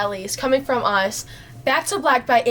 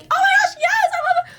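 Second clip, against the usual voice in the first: much higher, shrieking with joy; excited screaming. Retold or imitated. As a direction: squeal like thrilled party girls. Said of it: "They were screaming like".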